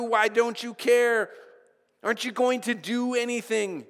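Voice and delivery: high, loud, frantic cry of doom; panicked disciples. Retold or imitated. Disciples in the storm crying out to Jesus, panicked and high. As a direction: wail like men sure the boat is sinking. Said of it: "they, they freaked out".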